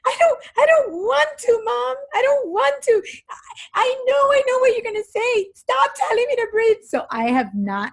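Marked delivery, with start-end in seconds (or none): 0.1-6.8 put-on very high, whiny child voice; imitating upset kids. Mother mimicking kids refusing to breathe, high upset whine. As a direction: protest shrilly like a kid in a tantrum. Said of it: "'cause they get so upset".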